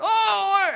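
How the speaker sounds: high, loud drawn-out wail; hammed-up cry of despair; mock crying out. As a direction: imitate someone wailing in distress. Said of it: "they're crying out, going".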